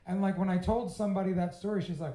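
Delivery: normal talking voice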